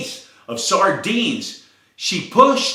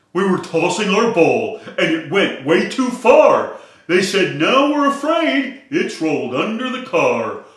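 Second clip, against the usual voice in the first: louder, flustered dogs pleading; upset Dalmatians; 4 semitones higher, 5 dB louder than usual. Acted. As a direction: sound like fretting puppies. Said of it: "The Dalmatians were flustered, quite clearly upset".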